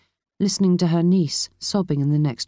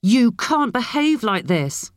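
high, slow angry scolding; raised-pitch furious reprimand; roaring; 7 semitones higher, slower than usual. A narrator's reading. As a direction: lay into her, stretching the words. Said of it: "grabbed her by the shoulders and roared".

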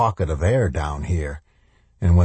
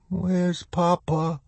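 put-on slow, high, dazed child voice; little girl; 11 semitones higher, 3 dB quieter, much slower than usual. A narrator's reading. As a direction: ask slowly as a weak small child. Dazed little girl asks from darkness.

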